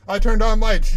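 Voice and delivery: turned punched loud. Shown as a demonstration. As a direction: insist loudly on turned.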